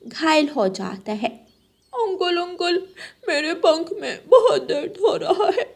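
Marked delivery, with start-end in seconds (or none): 1.9-5.6 higher child voice, a light mock whimper; lightly played hurt little boy; high whiny little crow. Injured Jaggu tells uncle his wing hurts, high and whiny.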